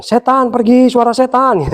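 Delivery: snapping at the devil, half in fun; mock rebuke. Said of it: "Hampir saya tenging".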